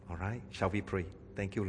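normal talking voice

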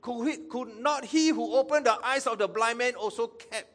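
high, loud challenge; skeptical challenge. Acted. Sceptical onlookers challenging Jesus at the tomb, loud skeptical challenge. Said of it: "okay, uh, skeptical".

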